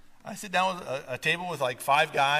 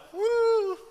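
long, high "woo"; high sustained woo of doubt; drawn-out woo. Retold or imitated. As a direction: let out a slow, high-pitched woo. Doubtful reaction to sink-or-swim story.

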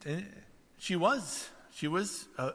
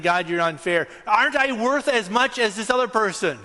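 jealous complaint; 7 semitones higher, 7 dB louder than usual. Acted. Acted jealous complaint to God.

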